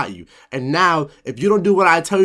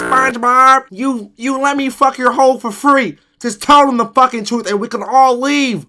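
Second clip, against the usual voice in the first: much higher, louder pleading Squidward; 9 semitones higher, 4 dB louder than usual. Acted. Squidward urging SpongeBob to confess, high and loud.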